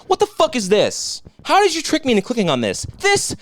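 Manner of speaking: high, rising mock-outraged whine; squeaky, exaggerated mockery of the commenter; mocking whiny commenter. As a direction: parody the troll's complaint. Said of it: "He wrote".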